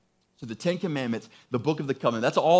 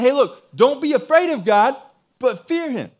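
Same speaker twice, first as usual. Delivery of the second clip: high, loud urging voice; urgent exhortation; 8 semitones higher, 7 dB louder than usual. Acted. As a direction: urge a frightened crowd as their leader. Moses voiced urging the people.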